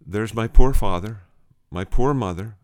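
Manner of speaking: retold lament, POOR leaned on loudly; emphatic lament. John laments his family, retold.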